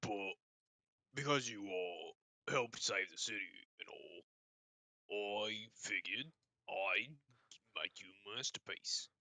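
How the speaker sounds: halting explanation, long pauses between phrases; nervous orc voice. Acted. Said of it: "having to stop that voice".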